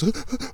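sharp, high mock gasp; a hammed-up startled intake of breath; imitating a scared person's gasp.

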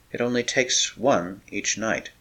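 barely above a whisper, begging; wife begging her husband, hushed; soft pleading. A narrator's reading. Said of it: "she pleaded".

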